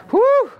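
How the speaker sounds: high, loud "whew"; relieved whoop. Acted. Believer released into Jesus's custody, high relieved "whew". Acting relief at the judge's verdict.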